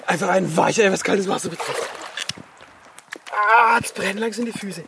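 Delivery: gasping from cold water; breathless cold shock; shivering in cold water. Real, not acted. Bathing in ice-cold stream.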